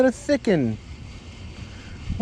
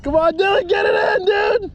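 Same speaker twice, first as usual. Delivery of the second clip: fighting a fish; 14 semitones higher than usual. Real, not acted. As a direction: cheer him on while the fish pulls. Fighting a fish, cheering friend on.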